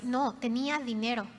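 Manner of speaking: normal talking voice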